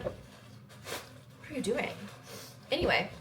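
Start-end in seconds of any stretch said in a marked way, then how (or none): 0.0-1.9 slow, breathless whispered aside; mock-baffled whisper at shoppers; whispered disbelief. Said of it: "I was like".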